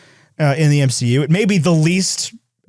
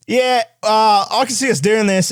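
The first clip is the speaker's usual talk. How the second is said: mock Australian accent; 6 semitones higher than usual. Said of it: "the Australian person responding".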